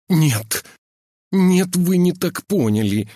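high, loud, panicked denial; sharp outburst. A narrator's reading. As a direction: cut in sharply, pleading. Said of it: "резко перебил фельдшер".

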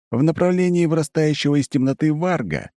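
ordinary delivery, nothing marked

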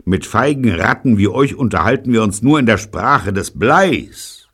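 loud, high, scornful declaration; defiant insult. Acted. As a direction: hurl it at the cowardly enemy. Insulting the enemy gang.

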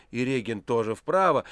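ordinary delivery, nothing marked

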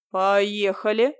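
slow, firm command; decisive. A narrator's reading. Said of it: "решительно сказал Андрей".

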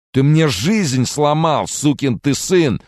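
yelling in rage. Acted. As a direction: scream the accusation at him. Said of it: "заорал Чарльз". (яростный крик, выше и громче, медленно)

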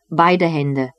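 ordinary delivery

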